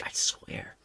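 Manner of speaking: breathy, voiceless promise; whispered aside. Real, not acted. Streamer swearing to chat, whispered. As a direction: whisper the vow. Aside after claiming it's real.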